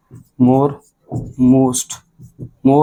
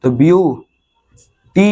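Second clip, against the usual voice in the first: Beautiful drawn out slowly, each syllable spaced; teacher stretching beautiful syllable by syllable; slow syllable reading; much slower than usual. Shown as a demonstration. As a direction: sound out beautiful slowly for the class. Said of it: "पढ़ो".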